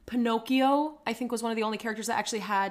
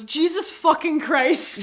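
mock outraged gasp; 5 semitones higher, 7 dB louder, slower than usual. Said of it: "everyone goes".

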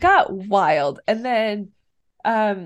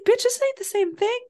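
much higher, incredulous attitude; sassy retort; 11 semitones higher than usual. Retold or imitated. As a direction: clap back with disbelief. Viewer retort to pep talk.

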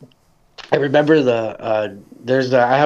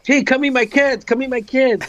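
gushing introduction; 10 semitones higher than usual. Retold or imitated. Woman showing off her "kids.

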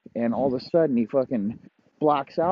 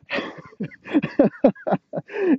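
high wheezing laughter; helpless high giggling; real laugh. Real, not acted. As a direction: crack up mid-story in a high laugh. Teller cracks up at own story.

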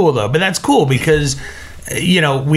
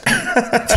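short laugh; 12 semitones higher than usual. Real, not acted. Laughs after stifling sneeze.

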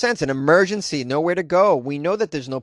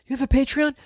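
mock listener question; 11 semitones higher than usual. Asks himself as imagined listener.

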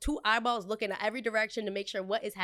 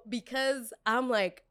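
usual speaking voice

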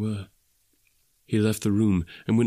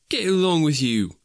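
put-on higher, good-humoured boy's comeback; grinning retort; 7 semitones higher, 4 dB louder than usual. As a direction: brush off the teasing with a grin. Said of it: "retorted Peter, grinning".

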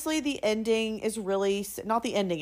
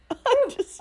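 much higher, laughing and near tears; laughing helplessly. Real, not acted. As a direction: crack up mid word. Laughing at a sad part.

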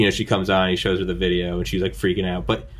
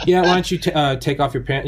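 higher, breezy doctor voice; mock calm doctor; 8 semitones higher, 4 dB louder than usual. Cardiologist casually asking patient, breezy mock voice. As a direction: casually ask like nothing's wrong.

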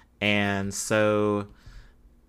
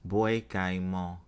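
French accent attempt. Shown as a demonstration. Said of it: "in my best French accent".

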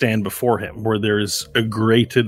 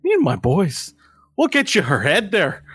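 high, boastful gang leader; scrawny little thug; 9 semitones higher than usual. Acted. Small thug offers the bounty target's head, high cocky voice. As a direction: pitch it up and boast for your boys. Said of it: "He's like".

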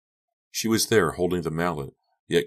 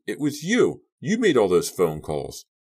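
high, loud outburst; shocked, accusing cry; screamed accusation; 5 semitones higher, 5 dB louder than usual. A narrator's reading. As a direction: shout the accusation, pitch leaping. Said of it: "Carrie screamed".

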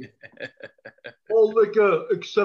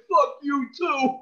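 slow, higher, laughing-crying retort; mock heckler yell; 8 semitones higher, slower than usual. Heckler telling the detective off, mock yell. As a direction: drag it out like a heckler cracking up.

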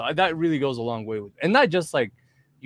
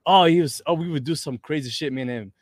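ordinary talk, nothing marked

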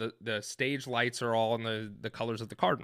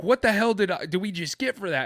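loud, urgent, high; frantic question; 8 semitones higher, 6 dB louder than usual. Retold or imitated. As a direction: demand an answer in a panic. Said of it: "I'm like".

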